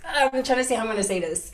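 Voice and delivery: ordinary talk, nothing marked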